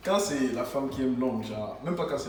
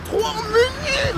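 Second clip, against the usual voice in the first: high, drawn-out shout; 11 dB louder, much slower than usual. Real, not acted. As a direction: yell it out long while rushing. Shouting while rushing.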